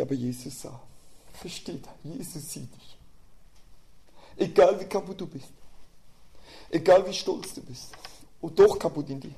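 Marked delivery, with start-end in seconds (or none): 1.3-3.0 soft, much quieter, gently assuring; tender reassurance. Acted. Preacher comforting a broken listener, softly. 4.4-9.3 fervent preaching. Acted. Preacher confronting the congregation's pride, fervently.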